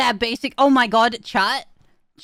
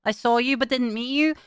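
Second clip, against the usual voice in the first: normal talking voice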